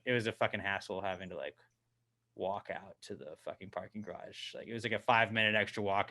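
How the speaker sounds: usual speaking voice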